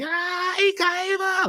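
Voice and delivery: a high, imitated cry of shock; hammed-up high-pitched alarm; mock shriek. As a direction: squeal it like Rogue seeing him fall. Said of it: "She's like".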